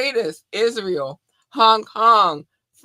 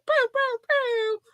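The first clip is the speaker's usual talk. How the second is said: much higher mouth-made pew pew airhorn; airhorn pew sound; slower than usual. Retold or imitated. Host wanting horns for Jamaica, high pew pew imitation. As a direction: fire off the pews like a dancehall horn. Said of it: "I need to get the sound like".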